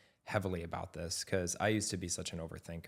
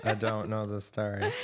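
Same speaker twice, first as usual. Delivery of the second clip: teasing denial said through laughter; real laughing denial; 4 dB louder than usual. Real, not acted. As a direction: deny it while cracking up.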